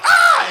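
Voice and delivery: sudden high yelp of fright; a startled driver's sharp scream. Retold or imitated. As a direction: jolt awake with a shriek. Driver jolts awake screaming.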